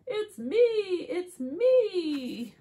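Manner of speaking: high, gleeful reveal; excited sheep. Acted. Sheep reveals itself under the mask, high excited cry. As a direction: sing out each phrase high and loud. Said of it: "says the sheep".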